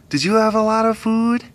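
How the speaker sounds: high, cooing question; pet talk. Real, not acted. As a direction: coo it to a pet. Talking to the pet.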